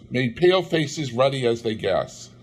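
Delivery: ordinary delivery, nothing marked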